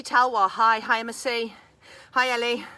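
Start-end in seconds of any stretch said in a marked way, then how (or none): none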